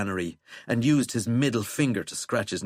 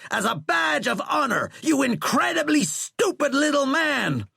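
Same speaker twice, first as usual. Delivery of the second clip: high, loud, scornful roar; furious roar; 5 dB louder than usual. A narrator's reading. General insulting the president who fired him, roaring. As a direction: hurl the insult at full force. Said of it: "Sheckley roared".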